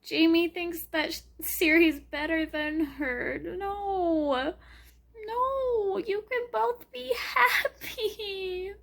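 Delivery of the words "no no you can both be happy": teary. Real, not acted. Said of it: "Okay, I'm gonna cry again".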